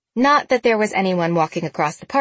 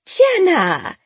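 high, loud happy call; delighted greeting; 11 semitones higher, 5 dB louder than usual. Acted. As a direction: greet her with joy at the door. Aunt greets niece at the door.

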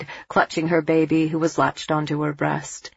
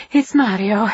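voice cracking with relief; 5 semitones higher, 5 dB louder than usual. A narrator's reading. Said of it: "her voice cracking with relief".

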